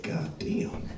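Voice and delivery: hushed unvoiced "God damn"; awed whisper. Customer hearing about pampered beef, whispering in awe. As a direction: breathe it out in disbelief.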